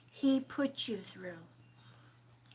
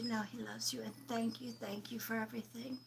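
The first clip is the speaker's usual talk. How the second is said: quick, hushed, softer whisper; 10 dB quieter, faster than usual. Real, not acted. Medium whispering a late husband's thanks to his wife. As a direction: murmur the gratitude quickly. Relaying spirit's gratitude.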